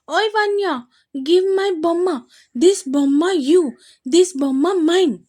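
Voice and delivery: put-on small boy fighting over a toy; angry little boy. A narrator's reading. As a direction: stamp every word like a cross toddler. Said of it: "అన్నాడు విహాన్ కోపంగా".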